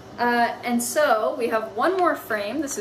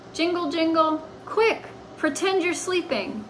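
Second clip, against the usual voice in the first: urgent; 6 semitones higher than usual. Acted. Keys and urgent cat.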